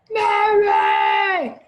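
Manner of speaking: high, loud shouted call; dad shouting for his daughter, high and loud. Acted. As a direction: bellow her name like a worried dad. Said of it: "called her dad".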